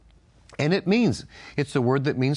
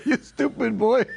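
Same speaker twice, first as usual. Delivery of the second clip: laughing; 10 semitones higher, 3 dB louder than usual. Retold or imitated. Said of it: "He said".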